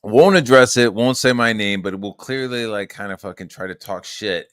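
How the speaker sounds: normal talking voice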